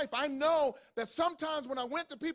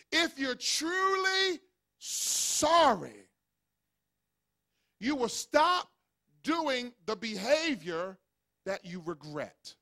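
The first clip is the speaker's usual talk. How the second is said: preaching; much slower than usual. Acted. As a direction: lean hard on truly, stretch it out. Preaching at full force.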